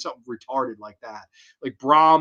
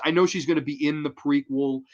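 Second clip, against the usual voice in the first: ordinary talk, nothing marked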